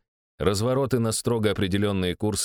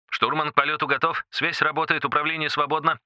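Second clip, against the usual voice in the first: formal military report; 6 semitones higher than usual. Acted. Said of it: "отрапортовал я по форме".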